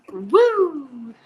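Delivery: high, loud whoop. Real, not acted. Host after a final shot, loud whoop. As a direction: let out a drawn woo after the drink.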